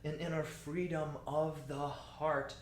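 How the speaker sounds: slow phrase, leaning on "freedom" and "heart"; measured recitation with weight on "freedom"; underscored freedom. Shown as a demonstration. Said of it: "In and freedom can be a little underscore".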